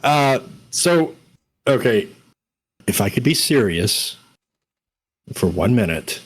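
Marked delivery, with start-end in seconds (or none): none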